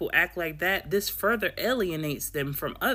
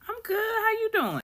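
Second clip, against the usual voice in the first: very high chirpy friendly reply; perky normal reply. Retold or imitated. The normal reply a friend should give, very high chirpy voice. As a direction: chirp back the expected answer. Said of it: "the normal response".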